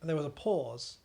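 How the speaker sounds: usual speaking voice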